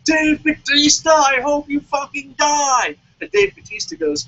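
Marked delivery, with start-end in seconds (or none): 0.0-3.0 slow, louder, furious fan yelling; mock yelling fan. Heartbroken fan yelling at Dave Bautista, slow loud mock rage. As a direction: bellow it at the wrestler like a crushed fan. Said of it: "he's screaming and yelling".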